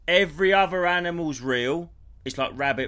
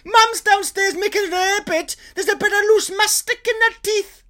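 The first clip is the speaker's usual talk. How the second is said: very high, loud outraged rant drifting Scottish; Welsh ranting into Scottish; 8 dB louder than usual. Retold or imitated. Snagging guy ranting as the accent slides Scottish. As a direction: lose it completely over bread and butter. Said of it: "It went Scottish".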